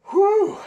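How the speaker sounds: loud winded whew, high; out of breath. Real, not acted. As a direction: blow out a big tired whew. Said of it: "let me catch my breath".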